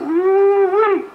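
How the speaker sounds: high, drawn-out woo-wee; delighted whoop. Real, not acted. Husband whooping with joy over his late wife, high and long. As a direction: let the joy burst out.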